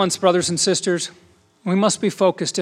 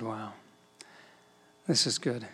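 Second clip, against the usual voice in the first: much softer and lower, taken aback; hushed awe; 7 semitones lower, 13 dB quieter than usual. Retold or imitated. The reporter reacting to Les, much softer and lower in awe. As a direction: breathe it out, moved. Reporter moved by Les's answer.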